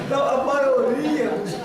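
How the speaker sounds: usual speaking voice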